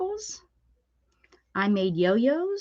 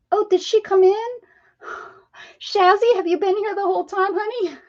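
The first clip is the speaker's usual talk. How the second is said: delighted surprise; 12 semitones higher, 5 dB louder than usual. Real, not acted. Surprised a viewer is in chat.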